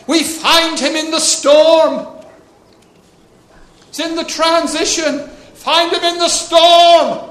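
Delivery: preacher proclaiming to the congregation; preaching at full force. Real, not acted. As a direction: drive the point home to the room. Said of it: "preaching climax".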